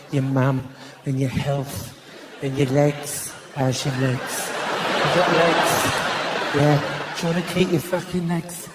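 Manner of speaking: low, menacing Cockney small talk; threatening Cockney friendliness. Acted. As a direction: chat like a friendly Cockney gangster. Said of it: "the more friendly they get, the more threatening it sounds".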